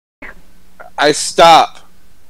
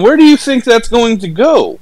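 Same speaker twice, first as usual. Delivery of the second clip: mock disbelief; 9 semitones higher, 4 dB louder than usual. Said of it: "I'm like".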